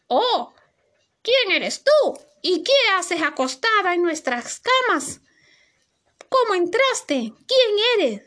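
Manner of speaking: preguntas agudas y sorprendidas; los enanitos interrogan, asombrados; sorprendidos. Acted. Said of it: "se sorprendieron". (los enanitos preguntan a Sofía quién es, sorprendidos)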